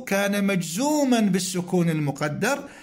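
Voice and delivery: ordinary talk, nothing marked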